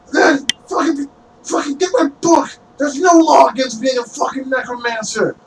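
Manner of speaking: high-pitched whiny protest; indignant necromancer. Acted. Necromancer to guard about his book, high indignant protest.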